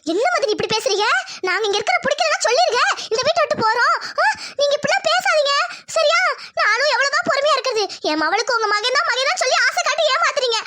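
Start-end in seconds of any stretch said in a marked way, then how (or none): 3.1-6.2 raised, crying complaint; tearful protest. Acted. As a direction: wail the threat to leave. Aunt threatens to leave, weeping.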